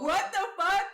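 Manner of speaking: high, loud disbelief; incredulous outburst. Retold or imitated. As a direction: blurt it out, appalled. Her stunned reaction to being followed.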